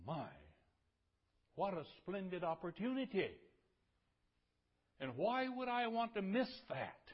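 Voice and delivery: high, loud exclamations with emphatic peaks; impassioned preaching. Real, not acted. Preacher marvelling at the chance to know God, loud and fervent. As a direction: marvel aloud from the pulpit, swelling on key phrases.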